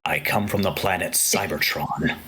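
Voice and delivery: lightly voiced robot leader, half whispered; a hint of a solemn Optimus; Optimus Prime voice. Shown as a demonstration. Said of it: "I can't really do it".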